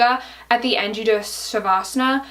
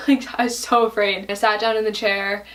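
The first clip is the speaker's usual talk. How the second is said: ordinary talk, nothing marked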